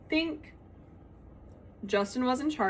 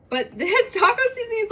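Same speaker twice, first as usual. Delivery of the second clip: laughing; 12 semitones higher, 6 dB louder than usual. Real, not acted. Laughing about spice.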